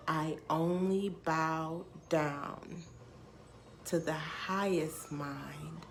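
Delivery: slow, drawn-out line, word by word; reverent quote. Retold or imitated. Quoting a lyric from the song that answered her, slow reverence. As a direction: let each word land slowly and solemnly.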